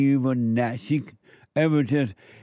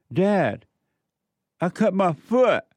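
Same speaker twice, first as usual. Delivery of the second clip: put-on hurt boy calling out; 6 semitones higher, slower than usual. Boy reporting his cut foot.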